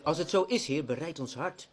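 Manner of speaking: earnest prayer. Acted. Pastor praying before his small congregation, earnest and pleading. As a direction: pour out the heart in prayer.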